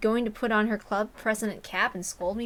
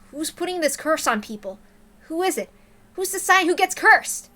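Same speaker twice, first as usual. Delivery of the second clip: rising higher and louder to a near shout; urgent pleading; 7 semitones higher, 7 dB louder than usual. Acted. Boy demanding Takano name the curser, rising urgency. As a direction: press harder with each question.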